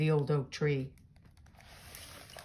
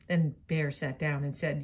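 usual speaking voice

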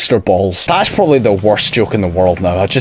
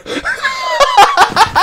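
high, shrieking laughter; high-pitched laughing fit. Real, not acted. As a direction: burst out laughing at your own joke. Cracks up at inside joke.

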